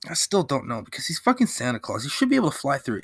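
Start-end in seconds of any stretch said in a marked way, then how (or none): none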